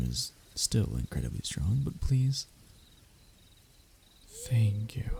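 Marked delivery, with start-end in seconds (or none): 4.3-5.1 whispered thanks. Acted. Captive whispering thanks to the dragon. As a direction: breathe the thanks.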